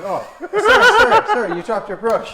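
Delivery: high, slow laughing call of sir; chasing a man down the street, calling out; calling after him. Retold or imitated. Calling after the shoe shiner.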